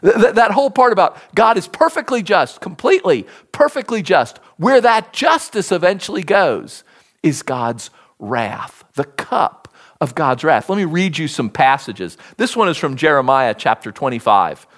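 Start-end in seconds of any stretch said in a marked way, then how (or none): none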